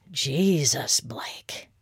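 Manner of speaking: breathless whisper, fading out; awed whisper. Acted. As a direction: whisper it, stunned. Awed reaction to reveal.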